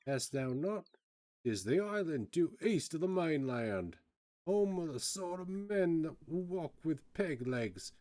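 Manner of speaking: high, swooping pirate storyteller; salty privateer relishing the tale; Cockney ex-privateer. Acted. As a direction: spin a sailor's yarn in a sing-song Cockney. Privateer describes pirate island.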